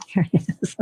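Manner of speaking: whispered through a laugh, quick. Real, not acted. Artist finding the lost cap, whispering with a laugh. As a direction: giggle it out relieved, whispering.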